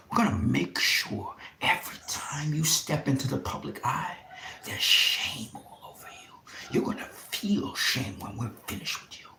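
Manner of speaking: hushed, breathy threat, leaning in close; menacing whisper. Real, not acted. Vlogger whispers a threat of public shame to leaders.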